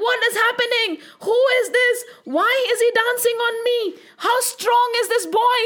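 high, wailing bewilderment; giddy serpent. Acted. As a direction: voice a dizzy villain starting to panic. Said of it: "started feeling so, so, so giddy".

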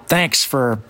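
normal talking voice

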